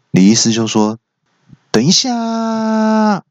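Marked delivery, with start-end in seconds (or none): 1.7-3.2 high, drawn-out mock-polite call; a drawn-out, high stalling call; stalling monk. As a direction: stretch it out slowly, high and sing-song. Monk halts the fight.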